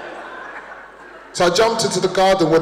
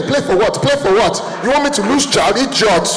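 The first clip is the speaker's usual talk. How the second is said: Nigerian mum; 4 semitones higher than usual. Retold or imitated. Nigerian mum telling off her son, scolding accent. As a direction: scold like an African mother. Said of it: "mum voiced in story".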